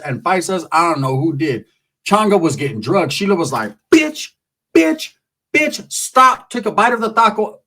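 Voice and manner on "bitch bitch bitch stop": high, punched-out mock fight yell; mock fighting shout. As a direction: imitate a woman punctuating each blow. Acts Sheila beating up Changa.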